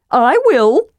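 high, eager kender voice; volunteer at once, pitched high; eager cry. A narrator's reading. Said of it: "Tasselhoff cried".

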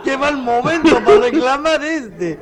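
high, loud words through laughter; laughing. Real, not acted. Listener reacts to the firing-squad punchline, laughing as he speaks. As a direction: comment while still cracking up. Commenting while laughing at joke.

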